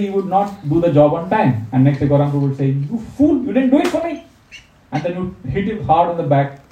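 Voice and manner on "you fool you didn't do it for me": high, mock-angry telling off; imitating a tough monk snapping at a junior; bossy scolding. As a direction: scold him like a bossy elder. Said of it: "He would boss around like anything".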